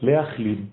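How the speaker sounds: « Leachlim » spoken clearly, falling at the end; Hebrew word. Shown as a demonstration. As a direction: say « Leachlim » with a guttural ch, stress on -lim. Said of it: "Comment on dit soigner et guérir en hébreu?". (prononcez « Leachlim » avec un ch guttural, accent sur -lim)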